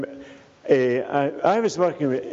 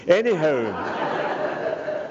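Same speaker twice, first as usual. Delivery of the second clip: laughing anyhow; 3 semitones higher, much slower than usual. Real, not acted. Laughs at his own pun.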